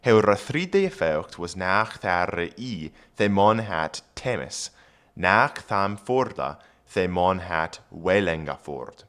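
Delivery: put-on Old English read aloud; careful Old English reading. Shown as a demonstration. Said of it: "reads Old English".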